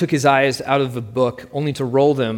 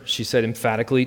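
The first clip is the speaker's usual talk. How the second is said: plain reading voice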